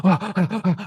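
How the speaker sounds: a sharp, mock gasp for air; short staged gasp; imitated panicked gasp. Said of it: "they think, "Oh".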